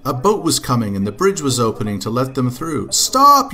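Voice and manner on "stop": high, loud cry of warning; storybook child's alarmed shout; yelling. A narrator's reading. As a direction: yell it out sharply high. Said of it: "yelled Katy".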